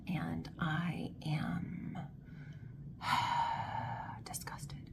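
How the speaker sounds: hushed, breathy whisper trailing off; whispered disgust. Real, not acted. Reader in a public place whispering her disgust to viewers. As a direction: whisper so nobody around hears. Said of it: "I am in a public place".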